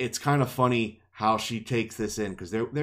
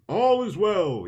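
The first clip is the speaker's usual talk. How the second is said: high, slow, sung-out watchman's cry; mock sentry call; 9 semitones higher, 5 dB louder, slower than usual. Imitating guards repeating their routine, mock sentry call. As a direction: draw it out loud like a crier on rounds.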